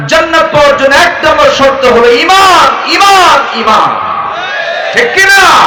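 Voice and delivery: high, loud rhythmic sermon climbing into a yell on Iman; full-force preaching building to a shout. Acted. Preacher driving home that faith is the condition, shouting. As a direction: hammer each line, then roar the answer.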